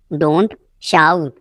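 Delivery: "don't", a long pause, then "shout"; word by word. Shown as a demonstration. Teacher drilling learners, one word at a time. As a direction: hold "don't" and "shout" apart.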